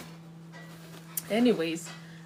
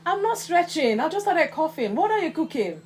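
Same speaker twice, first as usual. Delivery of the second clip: protesting call; 8 semitones higher, 4 dB louder than usual. Real, not acted. Arguing with boy in kitchen.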